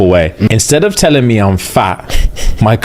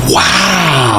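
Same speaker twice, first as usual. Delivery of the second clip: shouted wow; 8 semitones higher than usual. Real, not acted. Reacting to mum's joke.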